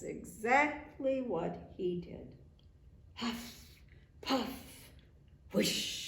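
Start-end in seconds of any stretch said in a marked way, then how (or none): 3.1-6.0 blown-out breathy huff, puff, whoosh. A narrator's reading. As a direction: blow the words out like wind. Wolf blowing the house.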